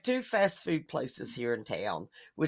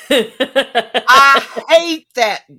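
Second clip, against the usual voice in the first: laughing; 6 semitones higher, 11 dB louder, much slower than usual. Real, not acted.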